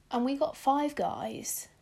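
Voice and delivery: usual speaking voice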